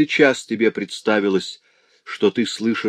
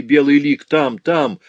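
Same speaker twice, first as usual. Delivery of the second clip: urgent, higher cry at a vision; feverish pointing; 5 semitones higher, 5 dB louder than usual. A narrator's reading. Sick man showing his friend a vision, urgent.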